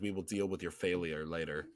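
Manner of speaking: quiet would-be Sith menace; attempted Vader. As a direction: try to be Vader without quite the gravitas. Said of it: "a try to be Vader".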